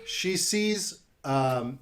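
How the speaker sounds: normal talking voice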